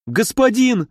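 high, desperate begging; pleading cry. Acted. The arrested traitor begs the prince, high and pleading. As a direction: beg the prince for mercy. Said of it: "взмолился Казимир по-литовски".

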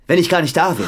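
jealous husband pushing; angry pressing. Acted. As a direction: lean hard on it.

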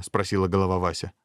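ordinary delivery, nothing marked

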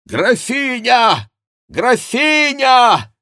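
high, loud, long-stretched calls; ringing, drawn-out shout. A narrator's reading. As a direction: yell the title as if across a distance. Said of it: "кричал голос".

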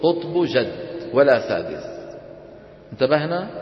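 ordinary talk

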